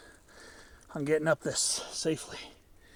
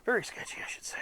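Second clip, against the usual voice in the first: whispering; 5 semitones higher, 3 dB quieter, faster than usual. Real, not acted. A nervous moment on the rock.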